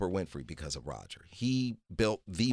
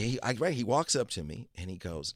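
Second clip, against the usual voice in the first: usual speaking voice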